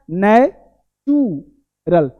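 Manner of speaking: नेचुरल drawn out very slowly, "na - chuu - ral"; syllable by syllable. Shown as a demonstration. Teacher shows learners each part, very slowly. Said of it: "क्या बोलना है?".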